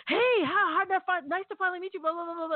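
high-pitched, hurried mock gushing; gushing greeting. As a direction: imitate an eager crowd babbling hellos. Mimics team's eager greeting all at once.

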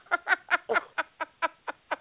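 high rippling laugh after own joke; laughter. Real, not acted.

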